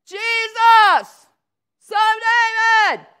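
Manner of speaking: full-force high shout over a crowd; loud, high cry for attention; yell. Acted. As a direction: holler it out desperate to be heard. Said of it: "And he yells".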